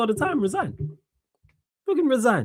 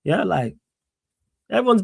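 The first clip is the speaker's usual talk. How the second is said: normal talking voice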